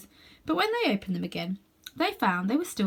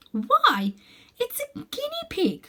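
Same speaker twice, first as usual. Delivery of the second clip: put-on surprised girl; 3 dB louder, slower than usual. A narrator's reading. Girl exclaims.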